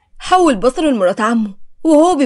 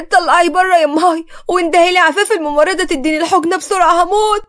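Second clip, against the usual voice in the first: high, pained, urgent protest; crying out in pain; 6 semitones higher than usual. A narrator's reading. Patient begging mother to fetch the nurse, crying out in pain. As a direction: cry out refusing, in acted agony. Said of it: "اتكلمت صفا برفض واعترضت وهي بتصرخ متألمة".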